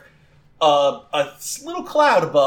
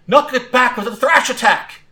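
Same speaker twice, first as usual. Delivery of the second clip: high, loud battle command; calling out; 9 semitones higher, 5 dB louder than usual. Acted. Rival trainer orders a thrash attack, calling out high. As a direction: shout the attack order across the field. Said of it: "Gwen calls out".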